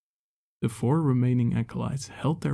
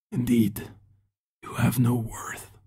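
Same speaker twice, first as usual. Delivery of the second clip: raspy. A narrator's reading. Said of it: "in a raspy voice".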